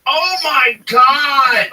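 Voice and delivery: loud, drawn-out oh my God; stretched, loud oh my God; loud exclamation. Real, not acted. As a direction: blurt it out slowly, loud. Laughing reaction to reading.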